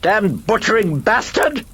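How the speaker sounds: put-on very high, loud British-accented screech; hoarse British shriek. Other personality curses the servant, a hoarse British shriek. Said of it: "shrieked in the hoarse British accented voice".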